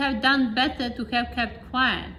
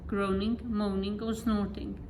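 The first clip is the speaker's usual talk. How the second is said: plain reading voice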